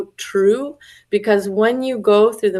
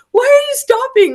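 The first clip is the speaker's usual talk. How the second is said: high-pitched indignant question, retold; acting out inner objection; upset disbelief; 4 dB louder than usual. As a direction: voice shooting up in protest. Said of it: "upset at her".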